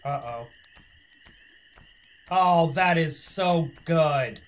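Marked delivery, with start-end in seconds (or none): 2.3-4.4 loud slow delight. Real, not acted. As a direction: savor it loudly. Delight at result.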